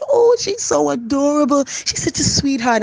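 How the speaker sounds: high, slow, gushing praise; mock sugary compliment; gushing sweet voice. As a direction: coo it like you adore her. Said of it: "you say".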